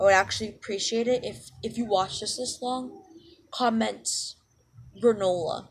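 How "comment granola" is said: ordinary talk, nothing marked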